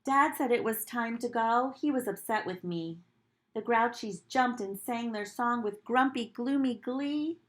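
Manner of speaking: put-on gentle read-aloud to children; storybook reading. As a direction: read it like storytime for little kids. Teacher reads picture book aloud.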